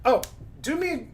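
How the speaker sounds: ordinary delivery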